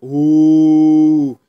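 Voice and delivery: loud drawn-out crowd "ooh"; roast ooh. Retold or imitated. Classmates mocking a roasted girl, loud teasing ooh. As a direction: hoot at a roast. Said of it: "you know how you roast somebody and they're like".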